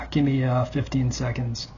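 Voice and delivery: whispered, winded request; out of breath. Real, not acted. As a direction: gasp it out, catching breath. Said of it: "I'm really out of breath".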